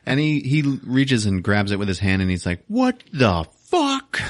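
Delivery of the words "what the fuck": slow, high-pitched mock outrage; outraged disbelief. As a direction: stretch each word in rising indignation. Guard hit reacts in outrage.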